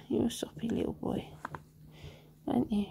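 soft whisper to the dog; hushed doting whisper; whispered cooing. Real, not acted. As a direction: murmur fondly to the dog.

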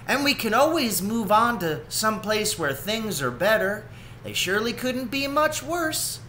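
a lightly voiced determined mother; confident Ma. Ma answers Esther.

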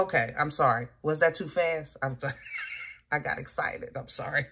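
soft, half-whispered apology with a giggle; sheepish apology. Real, not acted. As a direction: apologise quietly, a bit embarrassed. Sheepish apology after blunt advice.

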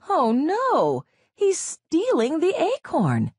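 高く大きな声で慌てて叫ぶ; どんぐりを盗まれて声を上げる; 驚いて叫ぶ. A narrator's reading. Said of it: "the detectives cried".

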